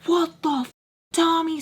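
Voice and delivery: high startled yelp of pain; pained outcry. Retold or imitated. Charlie yelling at Tommy after the hot sauce, high pained outcry. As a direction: cry out as the sauce hits.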